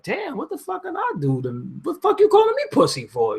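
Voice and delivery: incredulous high squeak. Retold or imitated. Replying to online troll comments, in a high baffled squeak. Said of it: "and I be like".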